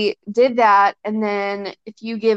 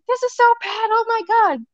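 much higher, whiny self-critical voice; mock dismay; 13 semitones higher than usual. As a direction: imitate yourself cringing at the video. Retelling her own upset reaction.

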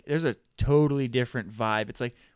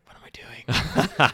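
laughing whisper; 4 semitones higher, 4 dB louder, slower than usual. Retold or imitated. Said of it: "and I was like".

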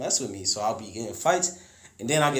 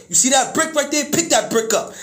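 much higher and louder, a father ordering his son; high, loud dad's command; 14 semitones higher, 8 dB louder than usual. Retold or imitated. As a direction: bark the order like a fired-up dad. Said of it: "And he's like".

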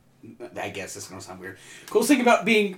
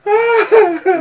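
high, squeaky words through laughing; helpless high-pitched giggle; squealing with laughter; 4 dB louder, much slower than usual. Real, not acted. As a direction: choke it out while cracking up. Squeals while cracking up.

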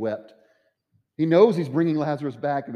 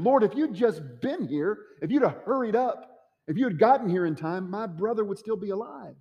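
pleading lament; 8 semitones higher than usual. Acted. Mary reproaching Jesus for delay.

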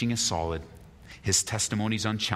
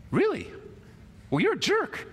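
indignant comeback. Retold or imitated. Believer insulted at work, huffy high retort. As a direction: fire back like you've been stung. Believer snaps back at coworker.

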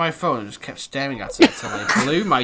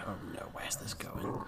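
whisper; 7 semitones lower, 19 dB quieter than usual. Real, not acted. Whispered aside while reading.

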